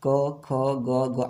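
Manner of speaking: क ख ग घ said very slowly, one by one; a teacher slowly sounding out letters. Shown as a demonstration. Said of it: "आप बोल के देखिए कहाँ से इसका उच्चारण हो रहा है".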